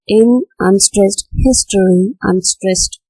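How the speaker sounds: ordinary talk, nothing marked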